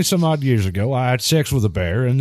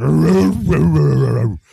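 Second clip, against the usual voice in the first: bear growl; 3 semitones higher, 4 dB louder, slower than usual. Retold or imitated. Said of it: "and he went".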